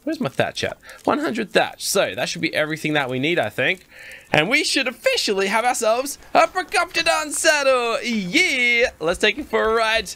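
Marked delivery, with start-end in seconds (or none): 4.3-8.9 high-pitched, celebratory announcement; triumphant. Real, not acted. Player announces the new saddle to viewers, triumphant. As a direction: proudly announce it with a cheer.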